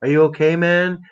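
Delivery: lightly voiced concerned friend filming; imitating concerned friend. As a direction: play the worried buddy checking in. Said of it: "he's like".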